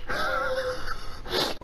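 very high, squeaky laughter; squealing laugh. Real, not acted. Gamer laughing with a friend after a near miss, squealing laugh.

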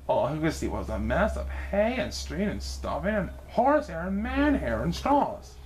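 plain reading voice

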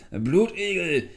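high, drawn-out shout; loud searching call. A narrator's reading. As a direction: holler the name into the distance. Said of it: "rief er wieder".

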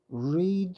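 "read" said reed, long ee; "read" as reed for the base form; present read as reed. Shown as a demonstration. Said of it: "동사 원형부터 과거 분사까지 읽어보면".

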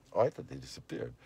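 ordinary delivery

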